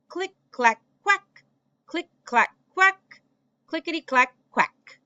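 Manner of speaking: high, loud duck quacks; quacking ducks. Acted. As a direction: squawk it like a duck.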